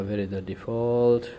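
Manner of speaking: ordinary talk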